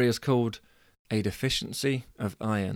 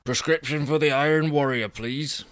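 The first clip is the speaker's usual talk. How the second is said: self-styled hero; 4 semitones higher than usual. Acted. Angus at pharmacy, voiced as character.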